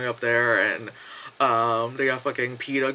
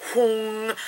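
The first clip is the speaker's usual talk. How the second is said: high humming whoosh; imitated beam noise; 7 semitones higher than usual. Lights beam down on the runners, imitated whoosh. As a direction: voice a beam sweeping down.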